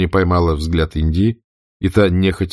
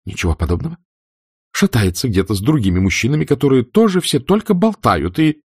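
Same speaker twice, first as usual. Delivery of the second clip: higher, rising indignant rant at Frank; angry tirade; 6 semitones higher than usual. A narrator's reading. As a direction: pour out the grievance, heating up. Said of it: "Её разгневанный взгляд".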